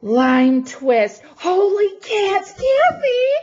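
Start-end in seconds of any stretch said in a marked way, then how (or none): 1.4-3.3 high, loud exclamation; amazed delight. Real, not acted. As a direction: call out in thrilled amazement. Exclaiming over gift to its sender.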